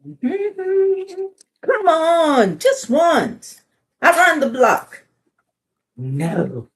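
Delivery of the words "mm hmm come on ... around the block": high, loud pleading bird voice; pigeon begging. Acted. Pigeon pleading with the reader to drive. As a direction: wheedle like a pushy pigeon.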